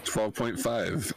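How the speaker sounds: normal talking voice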